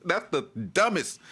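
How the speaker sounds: high, incredulous laugh spilling into the words; real laughter at something absurd; laughing in disbelief. Real, not acted. Laughs at the claim he repeats.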